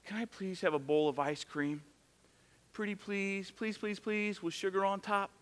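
put-on pleading little girl. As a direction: coax it out sweetly, pretty please. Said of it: "she'll come to me and say".

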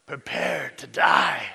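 slow, shouted movie-hero threat; swordsman's challenge. Retold or imitated. Preacher quoting a movie swordsman to the congregation, slow shout. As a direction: bellow it like a vow of revenge. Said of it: "and he goes".

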